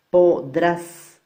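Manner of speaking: "Podrás" split, loud and high, DRÁS stressed; final-syllable stress. Shown as a demonstration. Teacher demonstrates an aguda word, loud stress on DRÁS in "Podrás". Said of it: "la mayor fuerza de voz recae acá".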